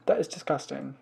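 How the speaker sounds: normal talking voice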